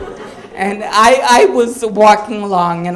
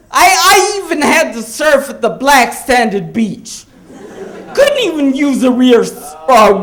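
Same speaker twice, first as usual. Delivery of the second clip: higher, louder hammed-up grievance; mock outrage; 7 semitones higher, 5 dB louder than usual. Comic mocks the tourist's expected sob story, loudly. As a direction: play it as fake suffering. Mock tale of Hawaiian hardship.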